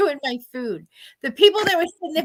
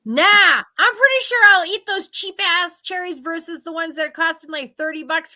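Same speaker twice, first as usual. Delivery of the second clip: sarcastic rant; 4 semitones higher, 6 dB louder than usual. Retold or imitated. Ranting about red dye.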